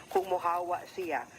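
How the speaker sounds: normal talking voice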